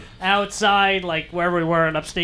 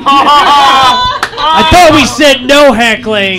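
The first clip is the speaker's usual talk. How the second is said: mock scolding; 7 semitones higher, 11 dB louder, much slower than usual. Real, not acted. Comedian mock-scolds heckler.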